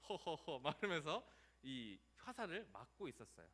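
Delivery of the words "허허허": unhurried, acted "ho-ho" chuckle; relaxed laugh. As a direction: laugh it off at ease. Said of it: "되게 여유 있게". (여유 있게 허허 웃음)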